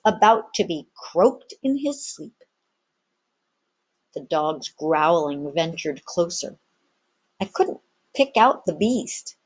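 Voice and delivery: usual speaking voice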